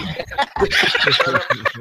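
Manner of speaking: long high-pitched giggle; real high cackling laugh. Real, not acted. Host laughs during the scolding.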